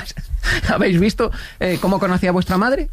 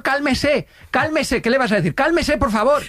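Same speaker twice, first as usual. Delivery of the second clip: urgent calming; 8 semitones higher, slower than usual. Retold or imitated. Calming panicking neighbour in elevator.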